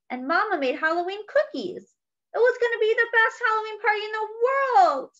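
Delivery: put-on a touch of a wobbly, upset child; lightly voiced little pig, near tears; tearful little pig. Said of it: "Pig let out a fresh burst of sobs".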